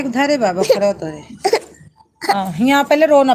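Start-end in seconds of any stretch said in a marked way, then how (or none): 2.2-3.3 louder, firm telling-off; scolding. Real, not acted. Mother scolds her feverish child for crying, firm and loud.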